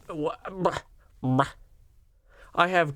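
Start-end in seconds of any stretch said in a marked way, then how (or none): 0.1-1.5 exasperated bruh. Real, not acted. Exasperated reaction.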